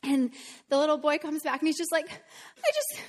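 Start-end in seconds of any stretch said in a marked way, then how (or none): 2.1-3.0 put-on very high, cracking child voice, tearful; imitating a sobbing boy. Teacher retells the crying boy, very high and tearful. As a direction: squeak it out like a heartbroken kid. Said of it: "he's just bawling and bawling".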